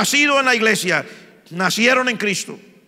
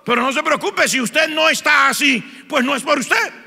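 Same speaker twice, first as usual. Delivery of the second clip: higher, louder, building sermon cry; fiery preaching; 8 semitones higher, 4 dB louder than usual. Acted. Preacher rebukes the congregation, rising to a loud preaching cry. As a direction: drive the point home to the pews.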